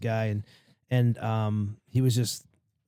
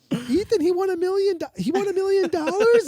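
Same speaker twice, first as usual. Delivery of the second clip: very high, incredulous mom voice; mock shrill mom astonishment; mom's shocked disbelief. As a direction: shriek like a stunned mother who hears about the millions.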